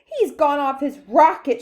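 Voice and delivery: high, loud accusation; outraged shout. A narrator's reading. A father shouting about Wonka, loudly. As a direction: shout it in alarm. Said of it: "shouted one of the fathers".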